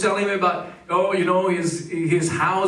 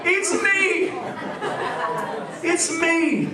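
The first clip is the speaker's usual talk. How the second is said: laughing confession; 8 semitones higher, much slower than usual. Real, not acted. Confessing he is sensitive.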